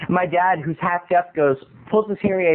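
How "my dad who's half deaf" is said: ordinary talk, nothing marked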